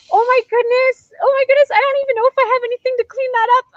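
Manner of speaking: high, loud, rushed fluster; retold panic. Cosplayer told about icing, high fast panic. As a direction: gush it out fast in a flap. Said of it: "I started panicking a little bit".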